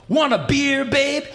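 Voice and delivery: high, loud party-guy offer; sling the offer out like a swaggering rock star. Retold or imitated. Rock singer offering a beer, high loud swagger. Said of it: "He goes like".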